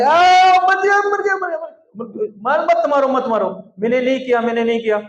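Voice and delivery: high, loud pretend wailing; mock screaming child. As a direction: shriek like a child faking terror of a beating. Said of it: "मैं जोर से चिल्लाने".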